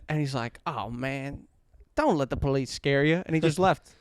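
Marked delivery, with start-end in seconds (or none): 0.7-3.2 soft, easygoing southern cop imitation; a light southern lilt, low-key; southern accent. Cop reassuring scared passenger, soft southern accent. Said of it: "he had a southern accent".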